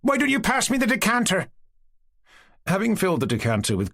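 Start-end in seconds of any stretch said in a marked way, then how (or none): 0.0-1.5 high, sharp demand; girl impatiently ordering the servant, cross. A narrator's reading. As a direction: order him, pitched high. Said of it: "the girl suddenly shouted".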